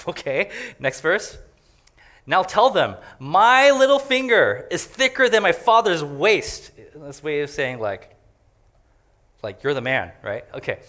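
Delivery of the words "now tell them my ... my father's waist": boastful king. Retold or imitated. Young men advise boastful reply.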